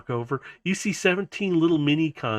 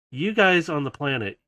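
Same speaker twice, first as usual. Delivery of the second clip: usual speaking voice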